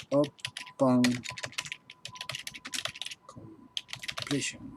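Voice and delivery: "upon completion" very slow and broken by long pauses; word by word. Shown as a demonstration. Teacher writing out a contract term for students, slow word by word. Writes out the term while reading it.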